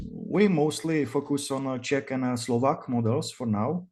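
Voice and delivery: Czech-accented English, relaxed interview pace. Real, not acted. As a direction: speak English like a native Czech.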